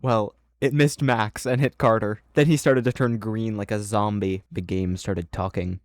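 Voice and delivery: ordinary delivery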